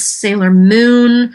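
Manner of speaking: usual speaking voice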